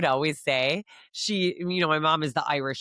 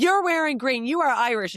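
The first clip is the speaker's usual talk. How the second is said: much higher, louder insistence; insisting; 7 semitones higher, 3 dB louder than usual. Retold or imitated. Mom telling her daughter she is Irish, insisting loudly. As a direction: insist it to her, raised up high. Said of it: "I'm like".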